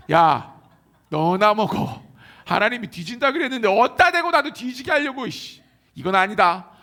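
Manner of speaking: loud scolding rebuke, throwing it back; angry refusal. Pretend. Wife snapping at her husband, loud and angry. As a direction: shove the apple away and scold her.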